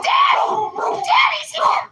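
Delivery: very high squeal of daddy's here; voicing the dog. Owner voicing her dog greeting her husband, very high squeal. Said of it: "She's like".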